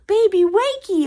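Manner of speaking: put-on high, loud excited toddler squeal; imitating big brother. Big brother greeting the baby each morning, high excited toddler squeal. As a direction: chirp it like a thrilled little boy waking the baby.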